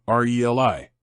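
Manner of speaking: R E L I as four letter names, ar-ee-el-eye; R E L I spoken as "ar, ee, el, eye"; correct pronunciation demo. Shown as a demonstration. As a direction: sound out R E L I letter by letter. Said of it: "the pronunciation remains the same".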